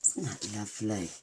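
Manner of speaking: usual speaking voice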